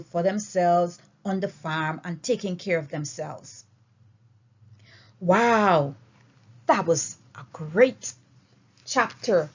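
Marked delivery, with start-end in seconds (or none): none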